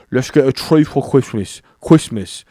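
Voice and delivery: R turned to W in "Christmas", said "Kwistmas"; regional British accent. Shown as a demonstration. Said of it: "they put the W instead of R".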